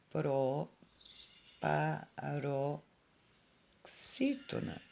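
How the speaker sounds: "proparoxítona" very slow, long gaps between syllables. Shown as a demonstration. Teacher writing the term for pupils, syllable by syllable. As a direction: say "proparoxítona" softly, one piece at a time.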